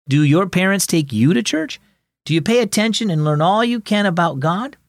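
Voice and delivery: ordinary delivery, nothing marked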